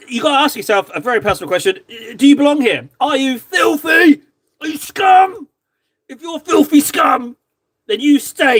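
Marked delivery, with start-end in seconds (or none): 2.2-8.6 shouted high mock insult to newcomers; gruff mock drill sergeant. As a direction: bark it like a mock tough sergeant. Mock welcome insulting new viewer.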